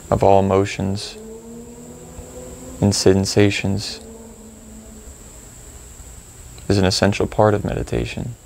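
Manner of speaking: calm, spacious phrases with long pauses; gently prompt, leaving room to notice each feeling; guided meditation. Acted. Guide leading listeners to reflect on procrastination, calm meditation delivery.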